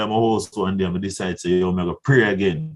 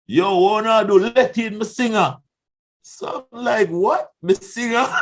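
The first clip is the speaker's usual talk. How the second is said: excited retelling; 10 semitones higher than usual. Retold or imitated. Excited exchange at the gate.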